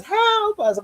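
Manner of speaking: high, hammed-up plea; mock cry for help. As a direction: squeal it out helplessly.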